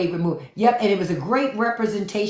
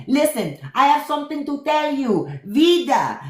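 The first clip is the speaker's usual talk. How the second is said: higher, sassy confiding tone; imitating Leguizamo's drag queen; 7 semitones higher than usual. Leguizamo's drag queen confiding to Vida, sassy. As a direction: spill the tea, sassy. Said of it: "John Leguizamo was like".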